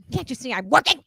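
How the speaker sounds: put-on voice of the bossy clown child; lightly voiced clown girl, irritated; clown girl's odd voice. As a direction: put on a strange, busy clown voice. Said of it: "You sound like that?".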